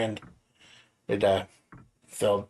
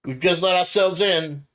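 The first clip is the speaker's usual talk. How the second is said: raised voice into the hall; calling out; 7 semitones higher, 4 dB louder than usual. Acted. Player character calls into the mansion, raised voice. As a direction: call out an apology.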